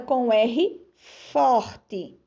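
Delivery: R forte said slowly with a strong guttural R; slow and drawn out. Shown as a demonstration. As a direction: stretch R forte. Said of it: "pintem de azul a letra R pronunciada". (R forte bem devagar)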